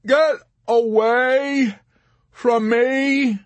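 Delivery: high, very slow wailed plea; pleading. A narrator's reading. Yossarian spent and helpless before Arfi, slow plea. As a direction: beg him with drawn-out words. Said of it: "pleading with him now".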